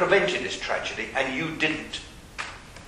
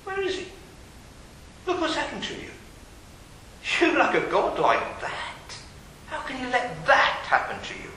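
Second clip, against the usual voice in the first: a challenging taunt, pitched up; mocking skeptic; 8 semitones higher, 4 dB quieter than usual. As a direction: needle them: where was God. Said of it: "someone would come along and say".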